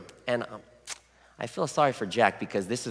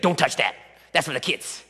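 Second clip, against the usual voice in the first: louder mock telling-off; imitated scolding wife; 3 semitones higher, 4 dB louder than usual. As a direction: snap it like a protective mother, playfully. Wife scolds husband over fruit.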